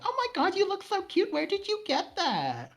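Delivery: shrill-high mock gush, slowing at the end; posh gushing women. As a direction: imitate the fake-sweet rich guests. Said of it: "they were like".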